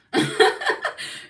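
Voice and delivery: loud, high burst of laughter. Real, not acted. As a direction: crack up at your own line. Laughs at her own joke.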